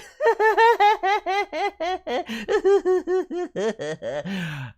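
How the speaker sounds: high, loud toon laugh that falls away; big cartoony laugh. Real, not acted. Host laughing at his own mute blunder, big toon laugh.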